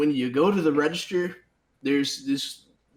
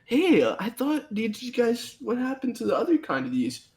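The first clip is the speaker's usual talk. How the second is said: higher questioning customer voice; 8 semitones higher than usual. Retold or imitated. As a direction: play a confused shopper. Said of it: "if they ask you questions".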